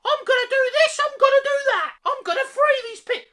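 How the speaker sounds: very high, loud whiny parody; squeaky mocking voice. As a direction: mimic her promises in a shrill squeak. Mimicking Daenerys's promises.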